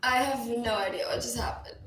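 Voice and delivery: normal talking voice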